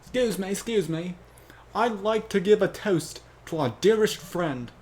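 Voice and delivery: high, loud British-accented toast. Shown as a demonstration. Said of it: "Why do I keep going British".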